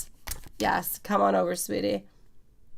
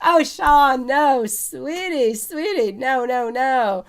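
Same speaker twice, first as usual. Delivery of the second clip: excited protest; 6 semitones higher, 5 dB louder, slower than usual. Real, not acted. Reacting to viewer quitting alcohol.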